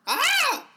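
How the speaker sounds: loud high-pitched shriek; high scream. Acted. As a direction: shriek in mock panic. Scream after outburst.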